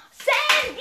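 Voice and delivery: high, loud wake-up yell; shouted name. Acted. Waking Sandy who fell asleep again, high shout. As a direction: yell the name to jolt a sleeper.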